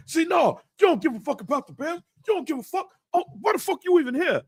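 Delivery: high, fast scolding; angry retold father. Father berates son for not caring, high angry imitation. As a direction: imitate a fed-up dad in a high, rushed rant.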